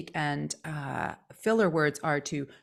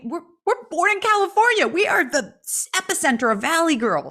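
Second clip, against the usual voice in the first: put-on Valley Girl voice; 10 semitones higher, 6 dB louder than usual. Mocking Californian Valley Girl.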